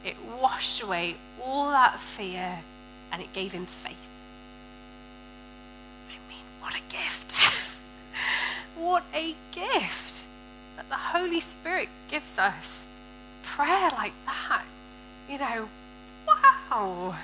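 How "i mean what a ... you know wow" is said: awed wonder. Real, not acted. Marvelling at the gift.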